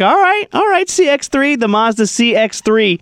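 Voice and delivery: high-pitched impressed quote, easing down; impressed exclamation. Retold or imitated. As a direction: squeal your approval of the car, mock-amazed. Retelling being impressed by quick car.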